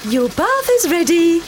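cheery call. Acted. Mum calls child.

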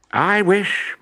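high, deliberate wish; professor wishing. A narrator's reading. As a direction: say the magic wish out clearly.

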